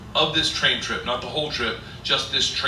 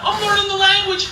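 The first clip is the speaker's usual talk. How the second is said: high, loud excited boast; acted exuberant delight; giddy pride; 4 dB louder than usual. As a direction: crow about it in a high voice. Proudly boasting of learning Russian.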